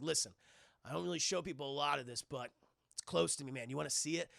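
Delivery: a calm brother-figure speaking low; Dom, low and laid-back. Acted. Dom praising Brian for treating Mia right, low and calm.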